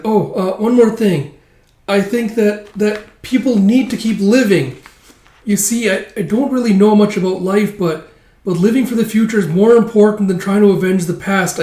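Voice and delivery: high, loud, eager elf voice; high-pitched hesitant sidekick; earnest little elf. Acted. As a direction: speak up high, working out a heartfelt thought. Elf earnestly advises old man.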